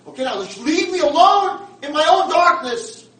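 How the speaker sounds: very loud, high, bitter shouting; wounded outburst at full volume; anguished furious tirade. Acted. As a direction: rage at him for not understanding. Blind man's furious outburst at roommate.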